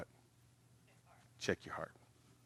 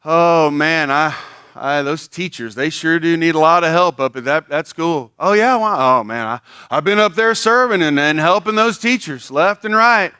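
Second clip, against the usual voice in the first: higher, louder hammed-up brag; humblebragging volunteer; 7 semitones higher, 11 dB louder than usual. Volunteer bragging about helping teachers, hammed-up and loud. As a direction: boast of helping while sounding weary. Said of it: "say stuff like".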